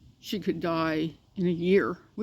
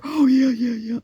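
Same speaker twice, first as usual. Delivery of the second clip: lightly voiced eager puppy, higher and louder; an eager yes from the dog; voicing the puppy; 5 semitones higher, 7 dB louder than usual. Owner speaking for her puppy keen to flatten boxes, lightly voiced eager puppy. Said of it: "And she comes over".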